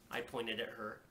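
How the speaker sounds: ordinary delivery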